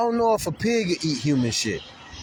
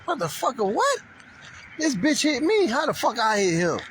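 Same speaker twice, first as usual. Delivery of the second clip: much higher, incredulous yell; indignant outburst; 10 semitones higher than usual. Retold or imitated. Cyclist to the police who blame him, high indignant yell. Retells outrage at police claim.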